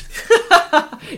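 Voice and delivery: squealing laugh; high laugh. Real, not acted. Laughs at the quote.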